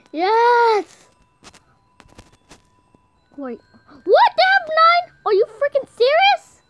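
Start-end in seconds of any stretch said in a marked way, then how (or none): 3.9-6.6 high loud disbelief; shocked outcry. Real, not acted. Gamer stunned by the enemy team. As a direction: yell it in disbelief.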